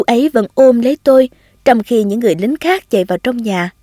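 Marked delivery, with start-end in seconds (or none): none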